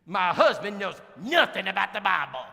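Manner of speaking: loud, exasperated wife voice; fed-up wife. Retold or imitated. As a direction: complain loudly about the husband. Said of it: "that's how she said it".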